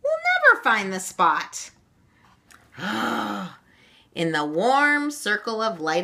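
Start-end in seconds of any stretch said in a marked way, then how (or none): 0.0-1.7 put-on higher, dismayed child's cry; frustrated outcry. A narrator's reading. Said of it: "cried Meg". 2.8-3.5 short audible gasp; sudden intake of breath. Acted. Gasp at the trick in story.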